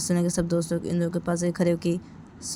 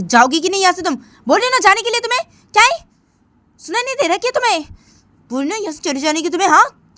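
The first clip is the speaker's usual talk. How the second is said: much higher, much louder angry shouting; furious yell; 9 semitones higher, 9 dB louder than usual. Acted. Simran after the slap yelling Sunaina away, furious and loud. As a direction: scream at her to go away.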